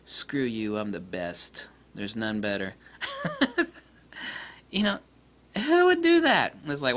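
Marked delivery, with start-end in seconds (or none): 0.0-2.7 lower, softer smug boast; a proud lens growls its superiority; cocky lens voice. Retold or imitated. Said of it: "This lens goes". 3.0-3.7 real high-pitched cackle; high laugh. Real, not acted. Laughs at own joke.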